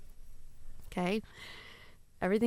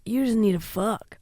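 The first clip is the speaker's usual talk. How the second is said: ordinary talk, nothing marked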